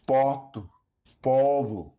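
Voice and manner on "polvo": ordinary delivery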